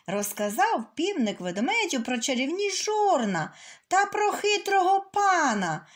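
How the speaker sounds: ordinary delivery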